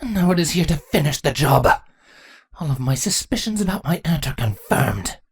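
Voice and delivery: contained regal bitterness; understated snarl. A narrator's reading. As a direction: snarl it with restraint as the queen. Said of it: "Chrysalis snarled".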